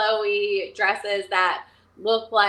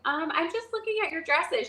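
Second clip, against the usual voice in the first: caught out; 7 semitones higher, 4 dB quieter than usual. Retold or imitated. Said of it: "so she caught me".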